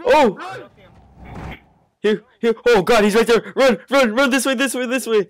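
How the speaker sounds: high frantic yelling; urgent panicked run calls; panicking gamer. Real, not acted. Monster reappears, fleeing.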